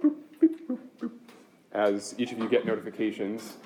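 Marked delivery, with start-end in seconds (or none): 0.0-1.1 high squeaky beep boop sounds; imitated notification beeps. Shown as a demonstration. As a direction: chirp the beeps like a tablet. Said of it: "start hearing a bunch of beep".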